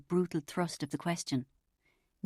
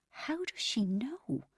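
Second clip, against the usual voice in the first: softer and slow, wondering aloud; quiet amazement; 5 semitones higher, 4 dB quieter, slower than usual. A narrator's reading. Narrator wondering to herself, slow and hushed. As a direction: hush it out slowly in disbelief. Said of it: "I wondered, amazed".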